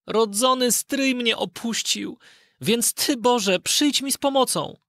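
put-on high, slow boyish plea to God; a frightened lad praying in the dark; Aladyn's prayer. Aladyn alone underground prays for help, slow high boyish voice. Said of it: "szepnął".

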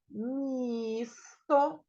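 місто with the first vowel drawn out; teacher's slow, careful місто; sounding out місто. Shown as a demonstration. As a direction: stretch «місто» slowly so each sound is heard.

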